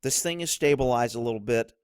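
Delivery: low, steady calming voice; voicing a cop calming himself down, low; calm self-talk. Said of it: "disengage and go".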